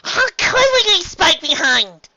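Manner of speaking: very high, slow, drawn-out question; bubbly Pinkie Pie. Acted. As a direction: stretch it out in a high cartoon voice. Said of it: "Pinkie Pie asked".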